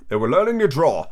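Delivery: slurred drowsy Dormouse; lightly voiced drowsy creature; yawning, sleepy. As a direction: mumble it half asleep. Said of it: "yawning and rubbing its eyes".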